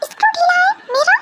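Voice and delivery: very high outraged echo; offended disbelief. Acted. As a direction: shriek back at the insult. Girl offended by insult.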